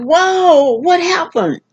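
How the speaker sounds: retold high-pitched "whoa" of shock; high, astonished exclamation; startled disbelief. Acts out her shocked reaction.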